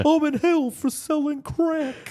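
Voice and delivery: very high, slow rapper parody; absurdly high mock rapper. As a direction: stretch each word in a squealed rap voice. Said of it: "do in your [name] voice".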